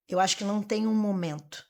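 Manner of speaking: usual speaking voice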